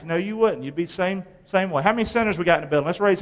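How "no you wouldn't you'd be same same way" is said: usual speaking voice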